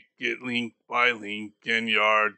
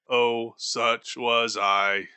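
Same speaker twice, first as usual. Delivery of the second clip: ordinary delivery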